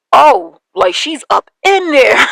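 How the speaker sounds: a hammed-up realization; mock astonishment. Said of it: "I was just like".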